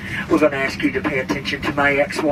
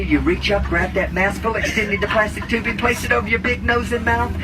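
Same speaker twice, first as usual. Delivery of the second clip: normal talking voice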